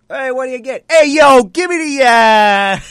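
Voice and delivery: loud, high New York order; New York deli accent. Retold or imitated. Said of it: "goes back to that deli in New York".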